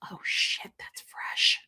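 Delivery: hushed, slow whisper; whispered quote. Retold or imitated. Quoting the lemonade scene to viewers, whispered. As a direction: whisper it, savoring the taste. Her own drink reaction.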